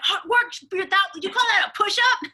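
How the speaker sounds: high, mocking drill sergeant cat. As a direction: bark like a tough trainer. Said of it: "She's like".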